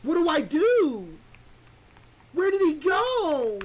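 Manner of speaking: high, loud, drawn-out frightened cry; stretched-out scared whine; panicked wail. Real, not acted. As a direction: plead with the empty room, scared. Panicking alone in game.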